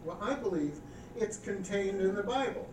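normal talking voice

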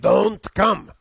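put-on loud, high, gravelly Russian accent; firm old Russian woman barking down the phone. Aunt telling her nephew not to drive up, loud Russian accent. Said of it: "She talked with gravelly voice, Russian accent".

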